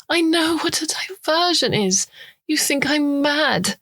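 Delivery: high, sharp retort, slowing at the end; indignant. Acted. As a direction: bite back, offended. Eve bristles at the insinuation.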